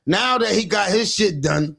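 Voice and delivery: ordinary delivery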